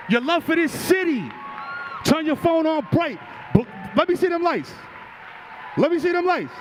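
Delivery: high, shouted hype call; crowd hype shout. Real, not acted. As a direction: whip up the crowd. Hyping crowd at rally.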